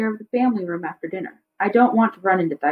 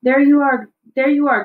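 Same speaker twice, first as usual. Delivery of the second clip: louder, higher cheerful greeting; hearty greeting; 5 semitones higher, 5 dB louder than usual. A narrator's reading. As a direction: greet her warmly and loudly. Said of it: "Baba says".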